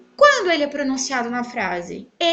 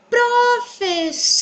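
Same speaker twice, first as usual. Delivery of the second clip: slow, high "Professora" leaning long on SO; "Professora" with a drawn-out SO; stretched stressed syllable; 9 semitones higher, 4 dB louder, much slower than usual. Shown as a demonstration. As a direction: spell out "Professora" in beats and lengthen the third. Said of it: "So é a tônica".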